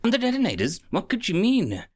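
bewildered. A narrator's reading. Kafka baffled.

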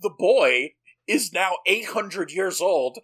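rising to a high, loud squeal; mock incredulous. Real, not acted. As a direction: build into shrieking disbelief. Incredulous outburst at sudden casting change.

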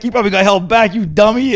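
high-pitched imitated kid insult; taunting kid. Kid going at another gamer, mock jeering. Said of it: "this kid is like".

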